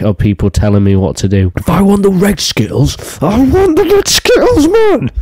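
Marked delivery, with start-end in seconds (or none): 1.6-5.1 very high, strained whine; high whiny voice. Pretend. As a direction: squeal it out like a spoiled kid. Mock whiny American rant voice.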